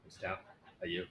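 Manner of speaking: normal talking voice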